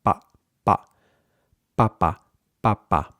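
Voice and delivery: pa and papa with lips curled in; unaspirated p in pa and papa; muted Spanish p. Shown as a demonstration. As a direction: curl the lips in, no puff on papa. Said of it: "round your lips or curl them in".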